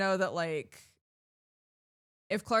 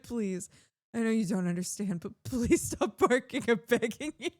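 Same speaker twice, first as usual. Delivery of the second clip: pleading; 5 semitones higher, 5 dB louder than usual. Retold or imitated. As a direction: mock-desperate begging, building higher and louder. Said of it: "just pleading with her".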